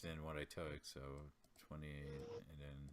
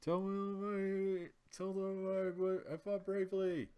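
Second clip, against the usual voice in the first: dramatic dying plea; 12 semitones higher, 10 dB louder than usual. Acted. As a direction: beg with your last breath, high and loud. Dying hero begs for his tale.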